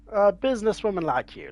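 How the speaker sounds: high, loud, quick mock repeat; mocking echo. Player mocking the NPC's words with a high, loud parody. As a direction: toss the line back in a mocking squeak. Player mimics own earlier line.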